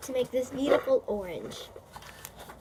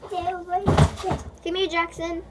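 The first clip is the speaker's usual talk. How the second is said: higher and louder, calling across the house; 7 semitones higher, 3 dB louder than usual. Real, not acted. Big sister calling little brother Jackson over, raised call. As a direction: call him over from another room.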